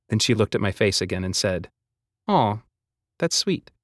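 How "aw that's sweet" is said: teasing. A narrator's reading. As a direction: coo it at him fondly. Hazel teasing fondly.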